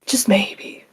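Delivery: 'Just maybe' breathed in a whisper. Shown as a demonstration. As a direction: drop to a sly whisper. Repeated, then whispered.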